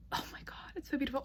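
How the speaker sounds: breathless, hushed whisper of wonder; soft, reverent hush over something lovely; awed whisper. Real, not acted. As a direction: whisper it as if the book might break. Admiring the book's painted edges.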